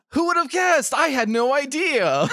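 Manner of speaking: high gasping mock amazement; gasp, then fake it's news; sarcastic surprise. Sarcastic reaction to obvious reveal.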